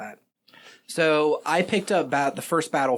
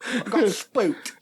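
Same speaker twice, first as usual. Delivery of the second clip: laughing; 9 semitones higher, much slower than usual. Acted. Laughing mock line.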